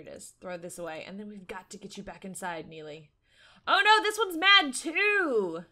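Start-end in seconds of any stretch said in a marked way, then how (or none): 3.7-5.6 high, loud, stretched cry; slow, high exclamation of alarm. Real, not acted. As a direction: wail in playful dismay. Another gnome gets angry.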